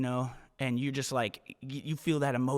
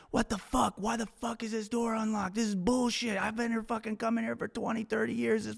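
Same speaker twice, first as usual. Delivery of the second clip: hammed-up furious stranger, pitched way up; imitating an angry yell; 9 semitones higher than usual. A stranger at the locked door, mock angry pitched-up rant. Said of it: "This guy starts yelling at her".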